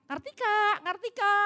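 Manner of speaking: high mock yell of a name; imitated calling out. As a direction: call like a neighbour from afar. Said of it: "dia teriak-teriak". (menirukan tetangga berteriak memanggil)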